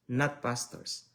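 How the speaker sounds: ordinary talk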